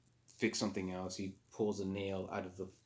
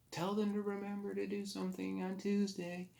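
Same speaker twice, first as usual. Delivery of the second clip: dying man's last wish; 12 semitones higher than usual. Retold or imitated. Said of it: "he's like".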